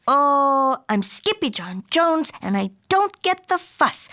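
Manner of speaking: high, bouncy kitten voice; playful kitten. A narrator's reading. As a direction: boast like a cocky kitten.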